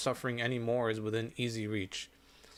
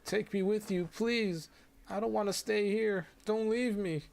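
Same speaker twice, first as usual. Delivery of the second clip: put-on high, tearful girl's voice begging; a frightened child begging not to be left; pleading young girl; 9 semitones higher than usual. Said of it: "Her cries mingle with tears".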